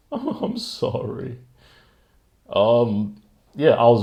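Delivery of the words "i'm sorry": slow, high apology through a laugh. Real, not acted. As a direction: laugh as you say sorry, drawn out. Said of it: "Can't you tell by the".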